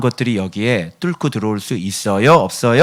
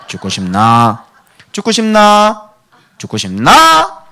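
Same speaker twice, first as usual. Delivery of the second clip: Gyeongsang dialect threat rising much higher and louder, slow; Gyeongsang accent; 10 semitones higher, 8 dB louder, slower than usual. Shown as a demonstration. Pastor drills the Gyeongsang dialect, building to a laugh. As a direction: climb into a hammed-up Gyeongsang shout. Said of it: "경상도 사투리".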